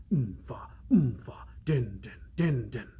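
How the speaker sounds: whispered oomfa din like a doom drum; drum chant whisper. A narrator's reading. Narrator whispering the doom drum closing in. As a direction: breathe the drumbeat out in a hush. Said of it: "The doom drum sounded closer".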